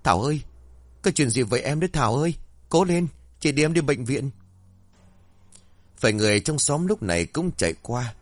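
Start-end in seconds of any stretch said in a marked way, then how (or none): 0.0-4.3 higher, fast, frantic calls; panicked cry. A narrator's reading. Sister finding her bleeding on the floor, panicked. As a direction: rush to her side in panic. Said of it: "cô thoảng thốt chạy lại".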